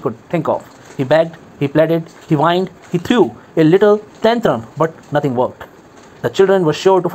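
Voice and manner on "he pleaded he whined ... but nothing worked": plain reading voice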